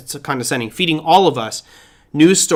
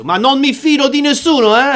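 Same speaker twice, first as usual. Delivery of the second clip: put-on higher, lively Italian accent on "Ma non mi fido di nessuno, eh?"; 9 semitones higher, 3 dB louder than usual. Teacher imitating his Italian students, in an Italian accent. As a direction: ham up the Italian lilt like a student in Italy. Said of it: "they would say it in a very nice accent using hand gestures".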